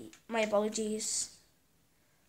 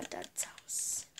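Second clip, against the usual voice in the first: whispering; 4 dB quieter than usual. Real, not acted. Touring dad's house in game.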